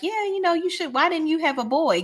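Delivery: high, light mocking voice; a mock high busybody voice; nosy question. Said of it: "She was like".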